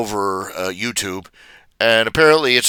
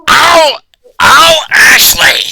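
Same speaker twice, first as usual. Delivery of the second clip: much higher, louder, slow screeching shout; Gilbert Gottfried; 10 dB louder, much slower than usual. Retold or imitated. Fan imagining a voice actor calling Ashley, screeching Gottfried impression. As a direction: screech her name like a cartoon parrot. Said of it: "in Gilbert Gottfried's voice".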